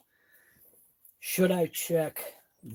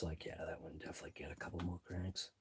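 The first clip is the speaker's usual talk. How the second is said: whisper; 8 semitones lower, 17 dB quieter, faster than usual. Real, not acted. Muttering to himself at work.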